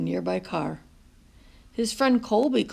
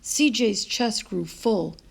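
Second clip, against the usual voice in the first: ordinary delivery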